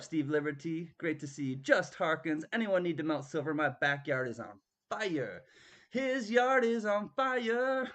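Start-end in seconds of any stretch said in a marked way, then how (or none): 5.9-7.9 mock alarm.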